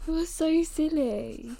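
soft, high, slow coo to a pet; fond teasing. Real, not acted. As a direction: tail end of giggles, tenderly teasing. Fondly talking to her dog.

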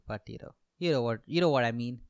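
normal talking voice

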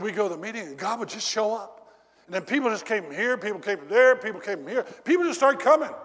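high, loud, fast eager retelling; excited recollection. Retold or imitated. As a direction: pile it up, building with excitement. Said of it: "Oh, man".

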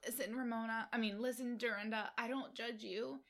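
high, thin impression of a lost voice; Tinsley. Reassuring a castmate she doesn't judge her, mimicked lost voice. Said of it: "her voice is still gone".

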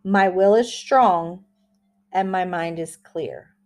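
slow, deliberate affirmation reading. Shown as a demonstration. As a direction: read each phrase slowly with pauses. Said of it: "I will say it slowly like I always do".

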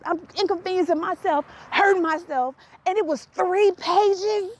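high, pleading exasperation at her mother; retelling her outrage over three pages; exasperated. Retold or imitated. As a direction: complain in disbelief, voice up high.

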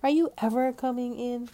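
loud, fast, high demand; exasperated shout to someone on shore; yelling. A narrator's reading. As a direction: yell it impatiently. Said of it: "yelled Stella".

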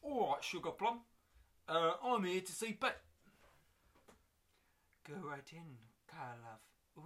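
high, loud cockney geezer greeting; breezy cockney lad, a hint of Michael Caine. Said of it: "Kyle is a bit cockney".

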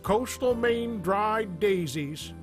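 upbeat radio commercial voice; ad read. Acted. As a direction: sell it like a cheesy radio ad. Sponsor ad read over music.

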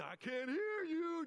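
drawn-out, high-pitched drill-sergeant holler; mock military yell at a recruit; Sergeant Carter. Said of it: "Gomer Pyle and Sergeant Carter going".

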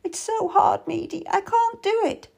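high, despairing protest; overwhelmed woman in labour; distressed pleading. A narrator's reading. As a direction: plead in a high voice that you can't. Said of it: "trying to catch my breath".

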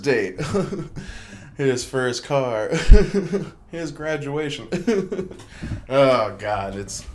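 real laughter breaking through each item; emotional dad laughing through the list. Real, not acted. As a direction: giggle helplessly between the milestones. Dad laughing at son growing up.

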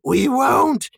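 high, loud creature cry; Gollum crying out. A narrator's reading. Creature shaking his fist east, loud high cry. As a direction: cry it out in defiance. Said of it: "he cried".

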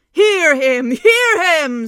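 loud rumbling approval; hearty cheer. Acted. Bears cheering the king's refusal to run, loud hearty. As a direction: boom your support like a bear.

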